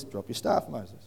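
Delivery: breathy, low whispered order; soft unvoiced divine command; God's hushed command. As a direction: whisper it like a voice from the bush. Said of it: "God says".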